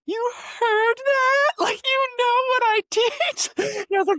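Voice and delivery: tearful laugh and cry at once; choked up. Real, not acted. Telling the host how her mom remembered yes and, choked up and crying. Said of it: "I'm getting choked up thinking about that".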